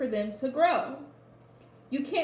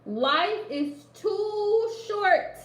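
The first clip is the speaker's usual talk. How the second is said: "Life is too short" drawn out slowly and higher, "too" stretched; slow emphatic; 7 semitones higher, 3 dB louder, much slower than usual. Real, not acted. Reader urging Danelle to enjoy life, slow and emphatic. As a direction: let each word land, slow and raised.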